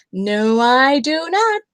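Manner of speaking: loud, slow shout. A narrator's reading. Bear refusing a bath, loud slow shout. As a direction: shout it out slowly. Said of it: "shouted Big Smelly Bear".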